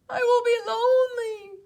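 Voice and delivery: high, loud tearful wail; crying. Acted. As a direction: sob out the fear. Toad crying.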